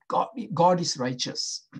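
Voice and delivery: ordinary talk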